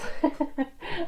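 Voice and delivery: short high-pitched chuckle; a brief high laugh to yourself. Real, not acted. Teacher asking students how they feel about pronouns, laughing.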